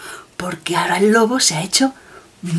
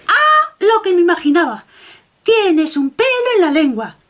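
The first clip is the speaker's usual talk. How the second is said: much higher, knowing little rabbit; rabbit doctor voice; 9 semitones higher, 4 dB louder than usual. Acted. Rabbit telling the wolf he has a hair on his tongue, high voice. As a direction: deliver the diagnosis like a tiny know-it-all rabbit. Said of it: "el conejito le dijo".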